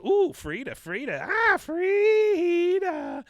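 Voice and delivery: drawn-out high wailing cry of Frida; mock wail. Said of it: "She goes".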